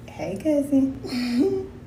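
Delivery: very slow sing-song "hey, cousin"; drawn-out greeting. Acted. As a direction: drag "cousin" slowly with a rise. Signature greeting to YouTube viewers.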